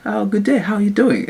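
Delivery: high mock British reply; chirpy put-on British greeting; imitated British accent. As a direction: copy their British accent back. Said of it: "talking to you with their accent".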